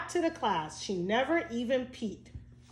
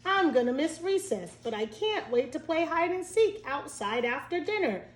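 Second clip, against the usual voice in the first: put-on child voice; 6 semitones higher than usual. A narrator's reading. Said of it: "said Alexa".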